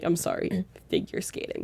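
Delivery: whispered sheepish correction. Retold or imitated. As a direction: mumble the correction, chastened. Mock apology, corrected.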